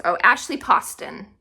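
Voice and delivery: ordinary talk, nothing marked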